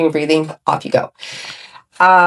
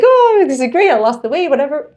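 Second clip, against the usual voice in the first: giddy; 9 semitones higher than usual. Retold or imitated. Retelling her past delight.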